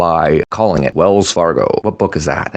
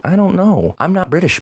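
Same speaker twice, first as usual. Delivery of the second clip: much higher, put-on British accent; mock British; 13 semitones higher than usual. Joking about Harry Potter to his cohost, mock British accent. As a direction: squeak it out in a silly British accent. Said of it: "That's where the proper English should be".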